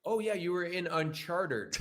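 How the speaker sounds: slow, laughing Uncharted said as Un-chartered; mock fans who say Uncharted like chartered; mocking mispronunciation. Actor mocking fans over his pet peeve, slow and laughing. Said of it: "here's my pet peeve when people say".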